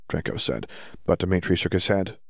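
plain reading voice

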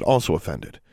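usual speaking voice

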